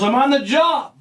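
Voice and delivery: acted-out cry of self-identification; urgent protest. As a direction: call it out to stop a cop firing. Acting out gunman's answer to police.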